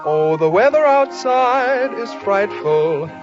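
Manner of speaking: ordinary talk, nothing marked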